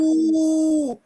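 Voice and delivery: high mock ghost ooh; ghost moan. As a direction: moan it like a ghost under a sheet. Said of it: "he said".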